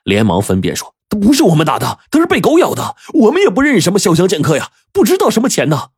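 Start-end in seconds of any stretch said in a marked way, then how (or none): 1.1-5.9 high, hurried denial; flustered, high-pitched pleading; panicked protest. A narrator's reading. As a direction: babble excuses to save yourself. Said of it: "一警察就急了呀".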